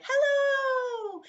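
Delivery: high, loud called-out hello; yelled announcement. Shown as a demonstration. Teacher showing how an announcement is yelled, high and loud. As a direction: call hello out to a whole crowd. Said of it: "If you're yelling it to everybody".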